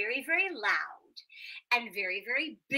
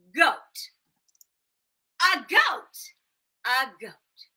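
loud emphatic advice; a touch of a sage neighbour; wise woman; 4 semitones higher, 12 dB louder, slower than usual. Acted. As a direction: announce the answer boldly. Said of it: "the wise woman said".

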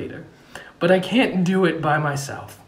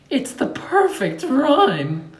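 high, slow gloating villain line; 10 semitones higher, slower than usual. Acted. Villain gloating to villagers, slow and high. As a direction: savor the evil plan.

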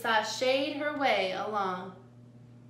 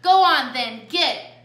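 loud barked dismissal, starting high; barked order; 3 semitones higher, 10 dB louder than usual. A narrator's reading. Coyote sends Jilly out of the tunnel, barking. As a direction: bark them away, loudest at the start. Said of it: "licked her chops and barked".

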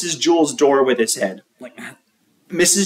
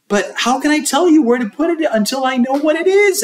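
high fast teacher; 12 semitones higher, faster than usual. A narrator's reading. Teacher questions.

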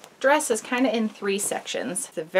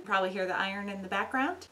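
ordinary talk, nothing marked

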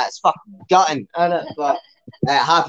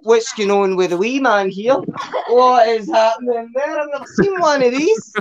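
acted astonishment; 7 semitones higher than usual. Partner sizing up the wee man, in mock astonishment. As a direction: climb higher as the disbelief builds.

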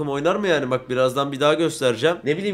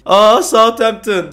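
mock surprise; 5 semitones higher, 9 dB louder, much slower than usual. Real, not acted. Spots Southampton fixture.

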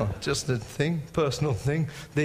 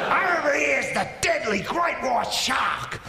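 high, shouted wildlife-presenter impression; Steve Irwin; 8 semitones higher than usual. Wildlife man presenting dangerous creatures to viewers, excited impression. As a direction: announce each deadly creature at a thrilled high yell. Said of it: "He is a man who found the most dangerous creatures".

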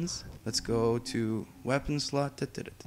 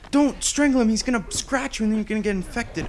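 high, loud urgent warning; panicked warning; 8 semitones higher, 5 dB louder than usual. Real, not acted. Gamer panicking as her character grabs the infected, shouting.

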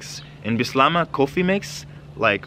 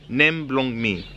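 slightly quicker teaching repeat; 3 semitones higher, slower than usual. Shown as a demonstration. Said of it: "teaching you some basics".

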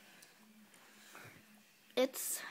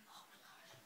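whispering; 26 dB quieter than usual. Real, not acted. Whispered disgust.

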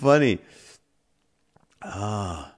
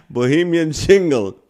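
usual speaking voice

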